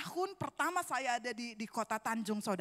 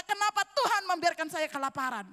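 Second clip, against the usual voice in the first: raised, loud complaint to God; retold protest; 5 semitones higher, 6 dB louder than usual. Preacher retells accusing God of leaving him hungry, protesting. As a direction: demand an answer from God, voice lifted. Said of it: "Dan saya berkata".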